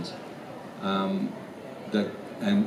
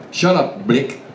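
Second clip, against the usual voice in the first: put-on South African accent; 5 semitones higher, 4 dB louder, slower than usual. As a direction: snap it out with South African vowels. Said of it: "It's a South African Blick".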